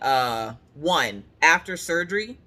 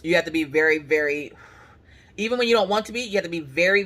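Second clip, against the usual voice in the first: ordinary talk, nothing marked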